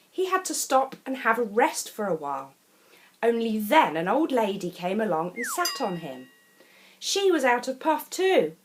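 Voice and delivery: ordinary delivery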